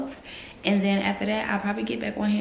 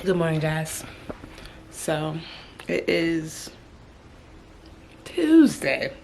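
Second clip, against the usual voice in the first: ordinary delivery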